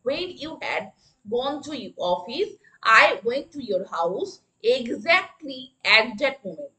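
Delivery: very slow, word by word; slow classroom dictation. Shown as a demonstration. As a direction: spell it out slowly for the class. Teacher repeats the sentence.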